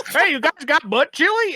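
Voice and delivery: high goofy prank-caller voice; high, silly put-on voice. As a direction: play a dopey caller asking for a fake game. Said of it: "you doing a silly voice".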